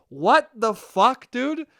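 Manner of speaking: ordinary delivery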